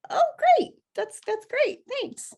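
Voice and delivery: high, strained little voice fading away; feigned delight. Retold or imitated. Retelling her reply to his offer, feigned high delight. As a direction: squeak out a thanks that shrinks to nothing.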